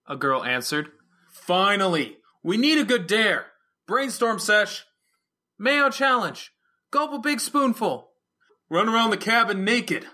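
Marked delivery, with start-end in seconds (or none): 1.3-8.1 high, loud, eager party cries; excited shouting. Acted. Kids shouting dare ideas at party. 8.7-10.1 put-on high, loud teen yell; shouting. Another kid yells a dare.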